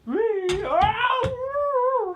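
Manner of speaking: sudden high yelp of pain; real ow. Real, not acted. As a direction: yelp as you get hit. Hit in game, yelps.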